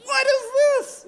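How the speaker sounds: very high, slow outraged question; can't believe the unfairness; indignant disbelief. Retold or imitated. Brothers reacting to their sister being let off, high slow disbelief. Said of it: "they're going".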